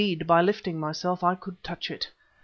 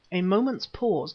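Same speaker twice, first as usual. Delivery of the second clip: ordinary delivery, nothing marked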